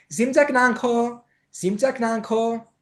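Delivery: high, slow, drawn-out sweet-talk; attractive and pretty. Shown as a demonstration. Teacher showing how to charm a Tibetan date, alluring high drawl. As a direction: sing it out alluringly, stretching the end. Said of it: "ελκυστικό και όμορφο".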